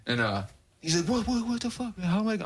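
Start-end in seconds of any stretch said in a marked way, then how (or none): 1.1-2.4 much higher, frantic stammering friend; mock panicky friend. Imitating his friend refusing to cross the freeway, high and panicky. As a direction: squeak out the protest in a mock panic.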